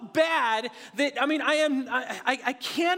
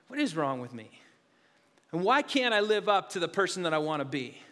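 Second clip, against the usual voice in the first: low, subdued questioning of oneself; self-reproach; 8 semitones lower, 3 dB quieter than usual. Retold or imitated. Pastor questioning himself after the flight, subdued self-reproach. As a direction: drop low, as if thinking aloud.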